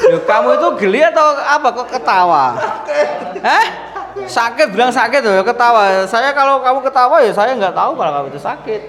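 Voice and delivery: high, laughing teasing scold, pace picking up. Real, not acted. Therapist teasing a giggling patient, laughing and high. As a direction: scold her for giggling while you laugh too.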